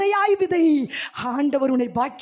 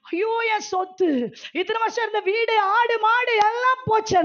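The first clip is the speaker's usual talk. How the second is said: higher-pitched acted wail of dismay; mock lament; 6 semitones higher than usual. Preacher voices Lot's wife crying over lost property, lamenting. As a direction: lament like a woman losing everything.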